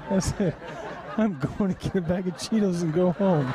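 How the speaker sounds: can barely get the words out for laughing; punchline said through real laughter. Real, not acted. Retelling punchline while laughing.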